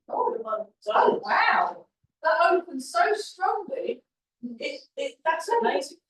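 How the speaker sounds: delighted, high and loud; genuinely amazed exclamation; excited. Real, not acted. Something opens strongly, delighted reaction.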